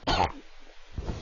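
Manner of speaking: coughing up a swallowed bug; harsh choking cough; coughing fit. Real, not acted. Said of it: "I just swallowed a bug".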